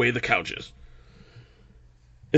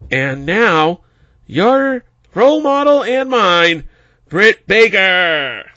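slow, stilted cue card read; halting announcer reading cue cards; reading aloud haltingly; 4 semitones higher, 8 dB louder, slower than usual. Retold or imitated. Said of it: "She was like".

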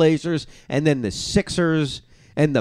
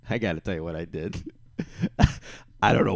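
laughing; 9 semitones lower, 7 dB quieter, faster than usual. Real, not acted. Laughing at his own story.

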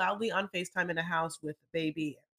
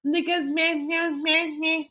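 mock Destiny babble; 9 semitones higher, 3 dB louder, slower than usual. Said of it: "And Destiny is like".

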